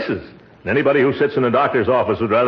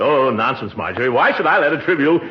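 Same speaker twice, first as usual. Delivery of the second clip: normal talking voice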